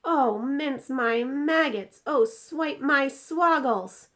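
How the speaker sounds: slow, loud, high dismayed oaths; drawn-out wail of dismay; aghast. Acted. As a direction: giant swearing in horror. BFG aghast at the dream.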